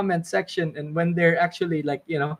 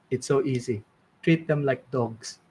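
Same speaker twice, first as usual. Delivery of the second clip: lower, softer, matter-of-fact sifu; a hint of a calm old mentor, lower and softer; deadpan advice; 5 semitones lower, 5 dB quieter than usual. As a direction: hand down the advice low and calm, lightly voiced. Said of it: "And then he goes".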